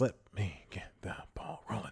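soft unvoiced whisper. Real, not acted. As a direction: breathe the words out. Whispered aside to listeners.

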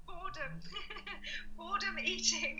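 boredom said through giggles; laughing repetition. Real, not acted. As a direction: giggle while repeating boredom. Repeating the word while laughing.

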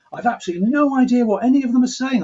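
high, loud, fast, amused exclamation; a father blurting it out while laughing at the TV; high, fast, delighted imitation of the father. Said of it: "my father would turn to me laughing".

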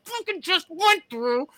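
retold angry rant. Man ranting to his girlfriend from the shower, high exasperated fury. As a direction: relive the fury of a bad shoot, voice way up. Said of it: "I'm like".

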